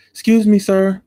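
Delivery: high, polite little voice; older customer stops the manager, high polite voice; older lady voice. Retold or imitated. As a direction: put on a sweet older customer's voice. Older customer voiced stopping Mike.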